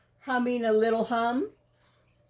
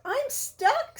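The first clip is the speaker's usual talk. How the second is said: stuck and distressed; 9 semitones higher, slower than usual. Acted. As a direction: cry out for help, squeaking high. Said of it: "said Pooh".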